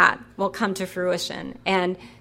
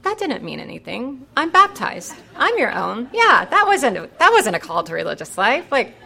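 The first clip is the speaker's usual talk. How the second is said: nervous rationalizing; 5 semitones higher, 5 dB louder than usual. Retold or imitated. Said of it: "I'm like".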